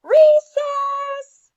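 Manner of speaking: high, loud shout of "Recess!"; joyful schoolkid yell; excited yell. A narrator's reading. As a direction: shout it like a kid at the bell. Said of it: "Aubrey yelled".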